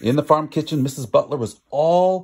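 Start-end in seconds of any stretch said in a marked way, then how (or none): none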